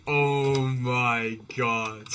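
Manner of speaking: slow, stunned oh my god; drawn-out oh my god. Retold or imitated. Streamer recalls people spamming links, stunned. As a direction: let it drag out in disbelief. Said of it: "I was just like".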